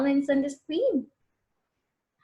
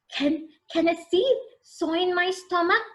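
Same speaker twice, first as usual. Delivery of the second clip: put-on higher, anxious little boy voice; worried boy; 7 semitones higher than usual. Connor asks the farmer about his stomach, anxious. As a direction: ask it nervously like a child. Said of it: "he asked".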